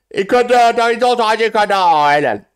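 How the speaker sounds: high, loud yell; excited outburst. Real, not acted. Reacting to Max Hopp's lead, high loud outburst.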